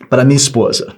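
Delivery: ordinary delivery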